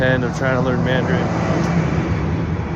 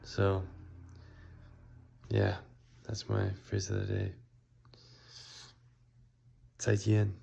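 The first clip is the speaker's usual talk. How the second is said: quiet; 6 dB quieter than usual. Real, not acted. Late night tired recording.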